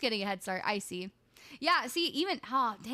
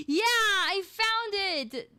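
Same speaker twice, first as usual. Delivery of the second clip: higher, louder, slow triumphant cry; 8 semitones higher, 7 dB louder, slower than usual. Real, not acted. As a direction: announce the find with delight. Finds the video.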